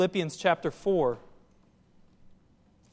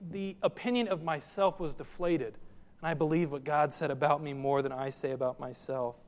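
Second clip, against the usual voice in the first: ordinary delivery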